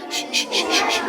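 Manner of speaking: urgent unvoiced shh; whispered shushing. Child hushing friends so the dinosaur won't hear, whispered shushing. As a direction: hush everyone, nothing but breath.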